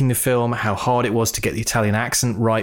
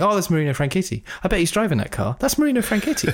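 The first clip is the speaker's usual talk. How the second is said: high, quick chatter that leaps at the end; mock excited recognition; 7 semitones higher, much faster than usual. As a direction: imitate yourself gushing at a sighting. Mimics own repeated recognition in cinema.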